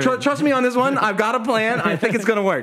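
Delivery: high, insistent plea for trust; eager persuasion. Retold or imitated. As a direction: play the overconfident leader selling a plan. Teller reassures doubtful team, acted.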